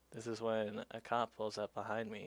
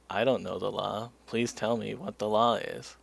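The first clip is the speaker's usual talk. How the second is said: loud admission at usual pitch; emphatic retort; 11 dB louder than usual. Retold or imitated. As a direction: re-enact pushing back, firmly and loudly. Cyclist's retort to the cop.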